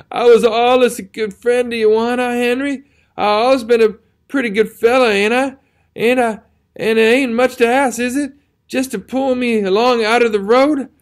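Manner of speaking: high, loud, babe-like pleading; desperate begging wounded soldier. A narrator's reading. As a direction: plead in terror like a lost child. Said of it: "to beg in a lowly way".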